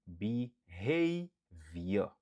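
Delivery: Behavior very slow, HAV leaned on; slow stressed model word; stress second syllable. Shown as a demonstration. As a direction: stretch Behavior, weight on the middle. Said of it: "li sou dezyèm silab lan".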